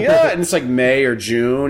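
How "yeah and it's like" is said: ordinary delivery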